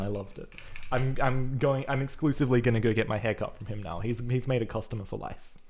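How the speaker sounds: usual speaking voice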